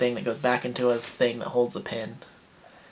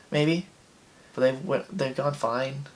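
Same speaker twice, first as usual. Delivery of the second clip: usual speaking voice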